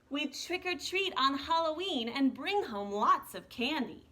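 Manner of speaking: soft, unhurried storybook reading; reading to children. Acted. Teacher reading the snowman book to her class, soft storytelling. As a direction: read the picture book gently to the kids. Reads picture book aloud.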